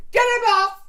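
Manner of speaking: high, loud shout to throw him out; a sharp shout to get him out; shouted order. Retold or imitated. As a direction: bark it across the room. Shouting at a greedy customer.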